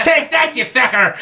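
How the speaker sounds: high, hammed-up Irish yell; mock Irish accent. Drunk Irishman smashing eggs on a friend, mock Irish yell. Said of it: "When everyone thinks Ireland".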